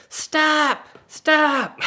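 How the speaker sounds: loud, high-pitched shout; distant warning yell. Retold or imitated. Bystander shouting up the hill at the driver, urgent yell. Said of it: "I hear from the bottom of the hill".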